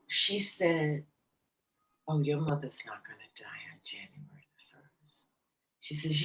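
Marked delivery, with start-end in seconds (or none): 2.1-5.3 soft whisper, lower, reassuring; whispered reassurance. Retold or imitated. Psychic telling her the mother won't die, whispered. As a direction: breathe it out as a calm whisper.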